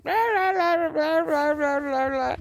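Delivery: put-on high rah-rah grumbling in a child's voice; imitated complaining babble. Grandmother mimicking grandson going off over dinner, high complaining babble.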